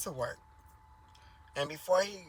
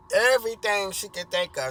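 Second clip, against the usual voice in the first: usual speaking voice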